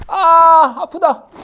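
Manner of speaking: loud, high yelp with a drawn-out "ah"; sudden mock outcry of hurt; acted cry of pain. Teacher acting someone pricked in the arm, loud pained cry. Acts out crying out when pricked.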